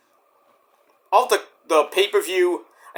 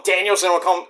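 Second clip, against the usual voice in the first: usual speaking voice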